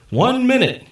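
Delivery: loud, high announcement; booming call. A narrator's reading. Ken calls the end of the bet, loud and high. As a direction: boom out the time. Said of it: "Ken's voice boomed".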